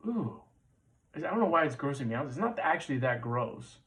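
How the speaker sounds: usual speaking voice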